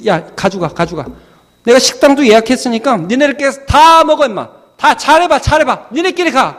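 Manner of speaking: huffy scolding rising high and loud; angry dad. Acted. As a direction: send the kids off alone in a temper. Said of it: "화가 났어요". (화난 아빠처럼 점점 높고 크게 토라진 말투)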